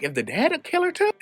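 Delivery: high, startled squeak of disbelief; shocked question. Retold or imitated. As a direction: ask it like you can't believe the twist. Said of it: "So I'm like".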